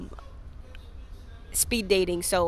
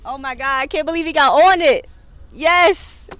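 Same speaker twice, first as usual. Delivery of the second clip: loud, high-pitched delight; thrilled the dog finally showed up; excited; 7 semitones higher, 9 dB louder than usual. Real, not acted. Host delighted the dog got on camera, excited.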